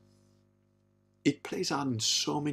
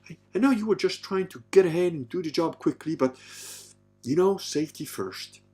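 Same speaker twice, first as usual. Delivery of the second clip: higher-pitched, exaggerated sweet-talk; mock coaxing partner; 7 semitones higher than usual. Imitating a man soothing a woman with a chainsaw, mock coaxing. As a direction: coax her like a delicate darling. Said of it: "you'd have to go like".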